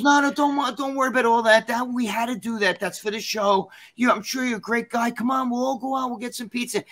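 high-pitched, coaxing reassurance; imitated friendly girl. Best friend inviting him for pizza, breezy reassuring imitation. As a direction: raise the pitch and wave the worry away.

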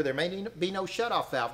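normal talking voice

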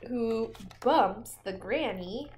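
slow and loud; dramatic. Pretend. Reading the chaos to the child, slow and loud. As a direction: stretch it out dramatically.

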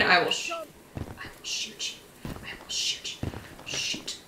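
whispered "I will shoot you"; whispered threat. Real, not acted. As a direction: breathe the threat out.

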